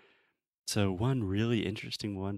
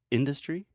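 IN-dustry, weight on IN; first-syllable stress; 6 semitones higher than usual. Shown as a demonstration. Teacher introduces the correct word, industry stressed on IN. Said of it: "we say industry".

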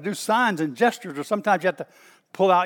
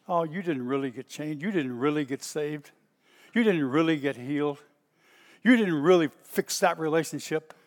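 normal talking voice

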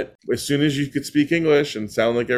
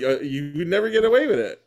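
ordinary delivery

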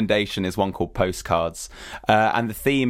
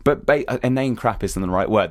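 ordinary delivery

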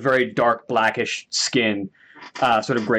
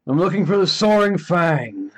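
lightly voiced old orc, calling; elderly orc calling out; 7 semitones higher than usual. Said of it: "an elderly orcish voice call out".

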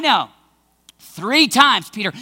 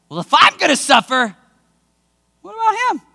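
whiny Peter. Acted. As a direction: complain like a jealous disciple. Peter's whining question.

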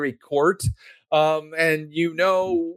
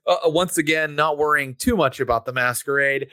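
ordinary delivery

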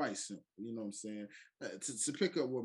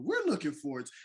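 announcer voice; 4 semitones higher, 7 dB louder than usual. Acted. Podcast intro announcer hype.

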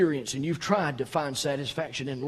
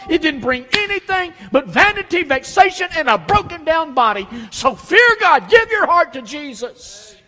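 much higher and louder, fiery pulpit preaching; preaching at full force; 13 semitones higher, 12 dB louder than usual. Acted. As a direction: hammer it home to the congregation. Sermon climax, preaching at full force.

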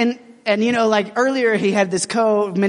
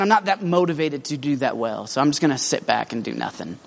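sulky resignation; 6 semitones lower than usual. Pretend. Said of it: "he could have just sat back and been like".

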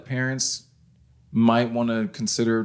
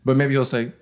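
ordinary delivery

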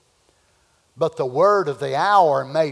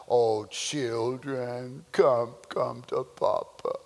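low, slow, soft coaxing daddy voice; mock soothing preacher; 7 semitones lower, 8 dB quieter, slower than usual. Preacher mocks soft pastor calling children, slow and soft. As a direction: coo to them like a doting papa.

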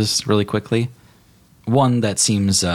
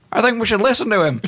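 very high, quick underling voice; high-pitched, hurried comic minion; 4 dB louder, faster than usual. Acted. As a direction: squeak it up timidly from the back. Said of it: "a low-ranking vampire officer, goes".

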